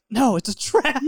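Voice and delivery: high-pitched alarmed refusal; hammed-up panic at a suspected trap; mock paranoid warning. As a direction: warn urgently, voice jumping up. Paranoid warning over the phone.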